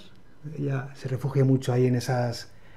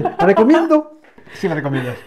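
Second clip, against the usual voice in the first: high, loud mock question, then drop to answer; teasing rhetorical imitation; 5 semitones higher, 6 dB louder than usual. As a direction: mimic a co-host's self-posed question. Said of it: "esa cosa retórica de las preguntas".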